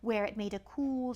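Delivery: usual speaking voice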